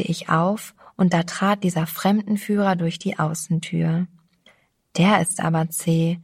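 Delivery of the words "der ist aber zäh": ordinary delivery, nothing marked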